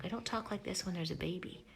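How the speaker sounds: ordinary talk, nothing marked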